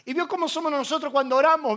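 normal talking voice

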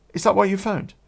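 mock indignant girlfriend; imitating the girlfriend. The girlfriend confronting him about cheating, high and indignant.

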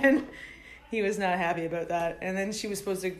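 ordinary delivery

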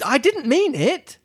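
put-on high, slow pleading girl; pleading Alice. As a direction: plead gently and slowly. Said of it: "pleaded poor Alice".

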